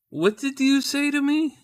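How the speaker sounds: high, slow, loud incredulous demand; mock confrontation. Husband confronting his wife over a pun, mock outrage. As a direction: challenge her with drawn-out mock outrage.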